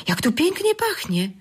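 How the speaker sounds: high, bright-eyed exclamation with pięknie lifted high; surprised delight, pięknie sung out high then falling; sudden delight. A narrator's reading. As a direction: blurt out the joy of a new smell, pitch jumping up. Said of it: "wyrwało się nagle z jego ust".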